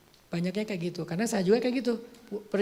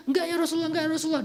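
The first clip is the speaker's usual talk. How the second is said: pitched-up stubborn refusal; insist, raised and earnest; higher insistent refusal; 8 semitones higher, much faster than usual. Acted. Abu Dujanah refusing Rasulullah while taking the arrows, in a higher insistent voice.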